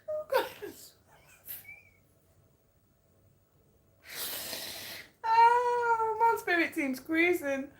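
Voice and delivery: very high, wheezing through laughter; laughing fit. Real, not acted. Reader tells viewers about her spirit team mid-fit, laughing. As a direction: squeal it out, helpless with laughter. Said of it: "laughing fit over".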